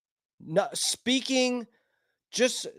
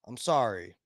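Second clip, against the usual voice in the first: ordinary talk, nothing marked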